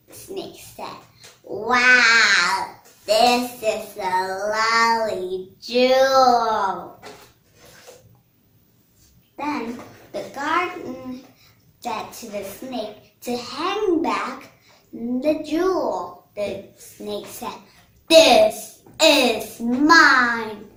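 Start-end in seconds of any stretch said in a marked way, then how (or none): 1.4-7.0 loud, drawn-out wonder, lightly acted; admiring snake. 18.1-20.7 very slow, loud, emphatic claim; possessive snake. Acted.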